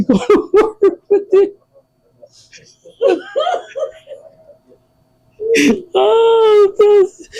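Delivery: high, laughing through the words. Real, not acted. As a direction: crack up while talking. Cracking up at her eating.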